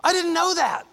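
a high, startled ah then amazed words; stunned surprise. Pretend. Viewer finding out he's blind, startled high surprise. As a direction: caught completely off guard. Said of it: "You're like".

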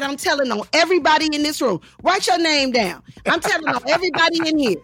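high, indignant mock tirade, rising in pitch; mad outburst. Auditioner threatens to tell on the casting room, mad. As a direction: rattle off threats to report them all. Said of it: "I got so mad".

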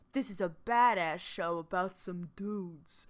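low, quiet mock promo voice; mock tough-show pitch. Host mimicking how the show was sold, mock tough promo.

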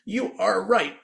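high robotic toy voice; automated toy voice. Retold or imitated. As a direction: mimic a chirpy electronic toy. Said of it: "automated voice that goes".

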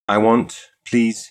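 precisely enunciated order; each word placed neatly. A narrator's reading. Customer ordering from the shop girl, precise. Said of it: "he said with precision".

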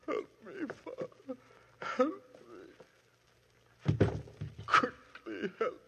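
high, faint gasping plea for help; dying plea. Acted. Shot Otto begging his father for help, faint and high. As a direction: gasp for help as you die.

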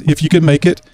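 usual speaking voice

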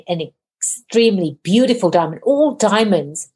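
ordinary talk, nothing marked

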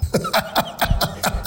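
amused breathy chuckle; high breathy laugh. Real, not acted. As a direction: laugh at your own odd story. Laughing at cheering rivals' team.

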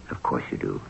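breathy aside, almost no voice; whisper. A narrator's reading. Narrator whispers an aside to the listener.

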